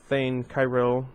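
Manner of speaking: ordinary delivery, nothing marked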